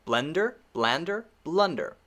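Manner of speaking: blender, blander, blunder as a careful list; contrasting short vowels. Shown as a demonstration. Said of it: "the only difference, just the vowels".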